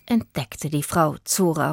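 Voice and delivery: usual speaking voice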